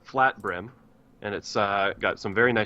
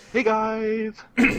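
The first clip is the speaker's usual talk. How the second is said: higher put-on greeting voice; silly high voice; 11 semitones higher, slower than usual. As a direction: chirp it in a squeaky put-on voice. Mimics Lispy greeting.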